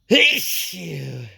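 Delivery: high gasping hey-you sneeze build; sneeze windup. Real, not acted. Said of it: "here come the sneezes".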